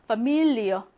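파밀리어 with a stressed 밀 and falling 리어. Shown as a demonstration. Teacher repeating the German word for family, 파밀리어 stressed on 밀. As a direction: lean on 밀 in 파밀리어. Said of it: "강세가 미 가운데에 있기 때문에".

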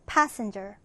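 Passenger with PAS strong and the rest light; stress on first syllable. Shown as a demonstration. Teacher modeling a vocabulary word, stressing the first syllable. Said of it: "첫 음절에 강세를 두고요".

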